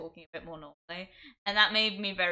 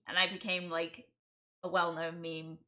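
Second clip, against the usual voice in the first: ordinary talk, nothing marked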